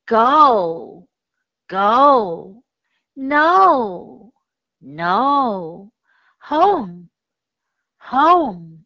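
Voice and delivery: slow, stretched 'Go', 'no', 'home' with a long o. Shown as a demonstration. Teacher models the long O for pupils, slowly. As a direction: draw out the long o in 'home' clearly. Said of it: "它的长音的读法，O".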